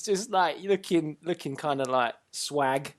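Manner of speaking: ordinary delivery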